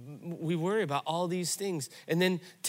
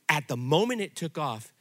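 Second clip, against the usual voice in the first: ordinary talk, nothing marked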